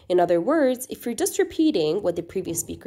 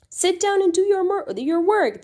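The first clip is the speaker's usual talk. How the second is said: much higher, louder stern order; mother scolding her mischievous child; 12 semitones higher, 5 dB louder than usual. Shown as a demonstration. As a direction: scold the naughty child firmly. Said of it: "the mother is saying it sternly".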